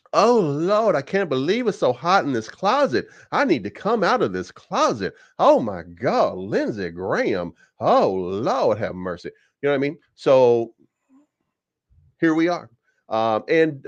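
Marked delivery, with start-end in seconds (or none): 0.1-9.3 put-on Southern belle accent. Vlogger mocking Lindsey Graham in a swooning Southern belle accent. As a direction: ham up a fanning, overheated Southern belle accent. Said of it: "one of the better Southern accents you're ever gonna find".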